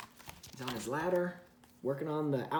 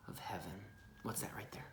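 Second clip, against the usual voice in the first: whisper; 8 semitones lower, 12 dB quieter than usual. Real, not acted. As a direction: lean in and whisper. Hushed close to child.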